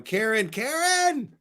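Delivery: high, slow, drawn-out "Karen, Karen"; imitating urgent call. Host mimics the caller shouting for her friend, urgent high call. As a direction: echo the caller's anxious cry, stretched out. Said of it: "the urgency where she's going".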